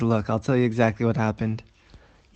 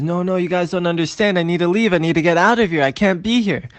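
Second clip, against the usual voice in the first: higher, urgent pleading; panicked protest; 6 semitones higher, 3 dB louder than usual. Acted. Shmulek begging his companions to let him leave, panicked. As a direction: insist desperately on getting away. Shmulek desperate to flee again.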